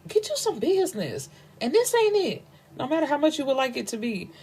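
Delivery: high, squealing sass; mock her with rising pitch; sassy high put-down. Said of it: "It's like".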